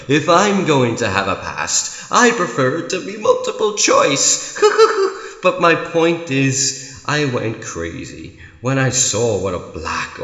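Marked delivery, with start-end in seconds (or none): 4.6-5.4 high villainous giggle; quick, high cackle at your own joke; short manic titter; Joker's giggle. Acted. Joker laughs at own line.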